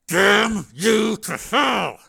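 loud, high, drawn-out venom; through gritted teeth. Acted. Wounded captive curses the man who shot him, gritted teeth. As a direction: force each word out through gritted teeth. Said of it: "he said through gritted teeth".

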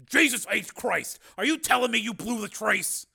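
high, loud, outraged question; exasperated. A narrator's reading. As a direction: demand to know who botched it. Marshal furious about failed trace.

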